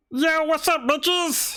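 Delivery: squeaky high, cocky greeting; tiny servant voice. Pretend. Tiny servant greeting the party, high and cocky. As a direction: pitch way up like a tiny cheeky robot. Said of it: "And then it says".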